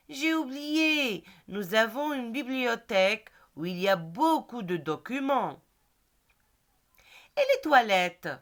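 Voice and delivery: clear, measured classroom read-aloud; reading a dialogue aloud. Acted. Teacher reading the Hakim and Rachid dialogue to pupils.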